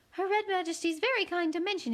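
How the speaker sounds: high, cooing like a pigeon. Acted. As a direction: coo it sweetly. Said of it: "like the cooing of a pigeon".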